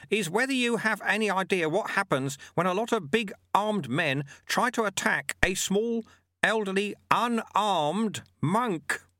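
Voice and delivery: high, measured voice of a canny monk; Lutze, wily old monk. Acted.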